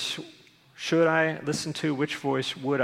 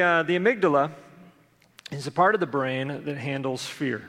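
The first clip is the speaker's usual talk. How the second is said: ordinary talk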